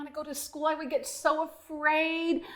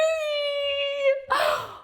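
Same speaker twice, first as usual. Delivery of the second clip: high frog gasp. Acted.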